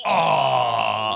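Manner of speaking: loud, high startled cry; surprised shout. Real, not acted. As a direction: shout out in sudden shock. Caster reacts to a fast kill.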